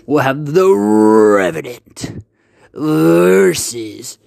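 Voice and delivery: loud, drawn-out announcer voice; booming fighting game announcer. Acted. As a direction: hype the matchup slowly. Announcing next episode's battle.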